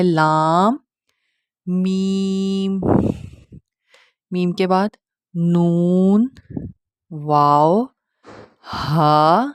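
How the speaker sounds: letter names held long; slow, deliberate letter recital; model letter pronunciation. Shown as a demonstration. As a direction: stretch each of لام, میم, نون, واو, ہا. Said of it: "کس طرح سے ادا کیا جاتا ہے".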